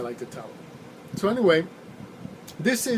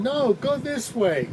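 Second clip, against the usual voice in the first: slow, high, drawn-out no from a tipsy rider; drunk insistence; 8 semitones higher, slower than usual. Retold or imitated. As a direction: drag it out high like a drunk passenger sure she's right. Drunk passenger insists on wrong way.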